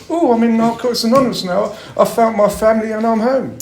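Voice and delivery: high, loud, gushing mockery of a newly saved convert; mocking happy clapper. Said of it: "become a happy clapper".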